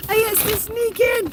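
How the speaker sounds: very high puppy talk. Real, not acted. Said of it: "repeated higher".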